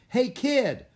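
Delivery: high, loud holler; loud coach's shout; coach calling out. A narrator's reading. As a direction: holler to a kid across the field. Coach calls to Jack across field.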